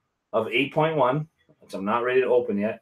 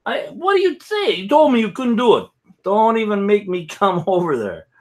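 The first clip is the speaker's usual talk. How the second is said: high-pitched, hammed-up telling-off; mock scolding; 9 semitones higher, 4 dB louder than usual. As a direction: scold a buddy in a jokey high voice. Mock quarrel with an absent buddy.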